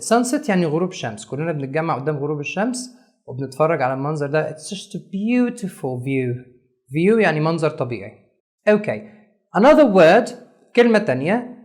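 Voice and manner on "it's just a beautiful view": very slow, softer, "beautiful" lingered on; slow and soft, savor "beautiful"; slow soft emphasis. Shown as a demonstration. Teacher demo, soft slow emphasis. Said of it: "عملنا stress on".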